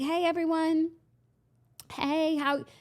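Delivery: a feeble bid to hush a crowd; retold calling out. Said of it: "I needed to do, like, a toast type thing and get people silent".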